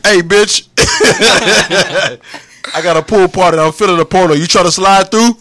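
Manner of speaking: high, loud hammed-up porn dude invite, laughing through it; mocking porn actor.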